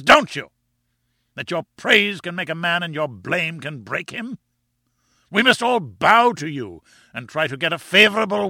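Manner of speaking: enraged professor. A narrator's reading. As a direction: berate him as a raging, domineering scientist. Enraged professor rants in story.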